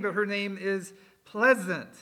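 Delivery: ordinary delivery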